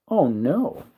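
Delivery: ordinary delivery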